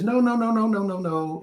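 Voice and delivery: high, rapid string of no's, mock protest; imitated flustered refusal. Retelling Tisha refusing a stranger's coffee, high mock protest. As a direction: play the startled star waving off his offer. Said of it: "And she says".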